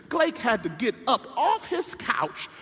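ordinary talk, nothing marked

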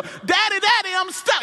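stuck son pleading with father; child calling. Retold or imitated. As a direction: cry for daddy again. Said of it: "He's still".

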